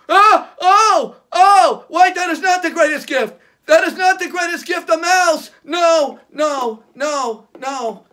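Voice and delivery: loud panicked shriek fading out; horrified. Acted. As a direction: panic at the mouse, then trail off. Horrified at mouse in box.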